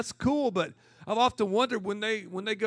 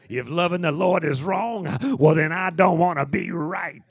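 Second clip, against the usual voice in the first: louder, rhythmic pulpit delivery; preaching rhythm; 9 dB louder than usual. Shown as a demonstration. Pastor demonstrating his rhythmic preaching style, loud. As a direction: preach it with swing. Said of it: "I just start preaching like that".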